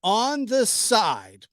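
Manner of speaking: "on the side" said slow and drawn out; imitating her slow phrasing. Vlogger mimics her slowly drawn "on the side". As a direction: drag "on the side" slowly like she did. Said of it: "that's how she says it".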